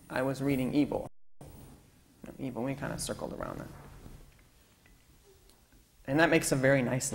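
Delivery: usual speaking voice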